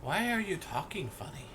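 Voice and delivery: put-on high-pitched puzzled kid voice; young boy. Boy questions the stranger's odd speech, high child voice. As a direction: ask it high, like a small boy. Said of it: "the boy just looks at you and goes".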